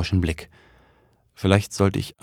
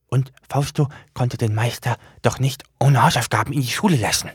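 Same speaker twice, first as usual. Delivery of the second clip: high, odd little demon voice; high demon voice, proud of itself; book demon voice; 5 semitones higher than usual. Acted. Demon explains himself.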